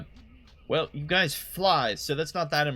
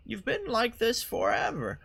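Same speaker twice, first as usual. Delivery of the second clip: put-on old lady voice; 9 semitones higher than usual. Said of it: "old lady George Bush".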